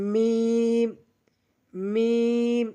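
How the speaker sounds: long, level "মিম" closed on both lips; two-lip sound. Shown as a demonstration. Teacher showing learners the letter, "মিম" drawn out evenly. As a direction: stretch "মিম" and shut the lips. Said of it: "দুই ঠোঁট হইতে".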